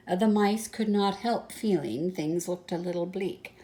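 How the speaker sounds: plain reading voice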